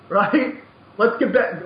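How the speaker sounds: ordinary delivery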